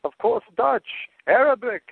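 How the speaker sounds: put-on high, slow Yiddish-accented father. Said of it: "and he says".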